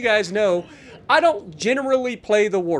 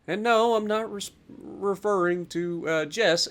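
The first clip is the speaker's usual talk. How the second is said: ordinary talk, nothing marked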